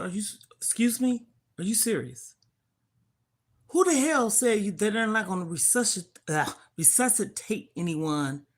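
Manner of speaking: loud, high indignant demand; Who the hell said that, indignant and loud; outraged disbelief. Retold or imitated. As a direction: demand an answer, furious. Outraged at the no-resuscitate bracelet.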